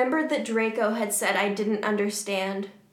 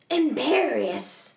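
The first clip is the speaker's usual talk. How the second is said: Voldemort shouting; 3 semitones higher, 3 dB louder, slower than usual. Retold or imitated. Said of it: "Voldemort shouted".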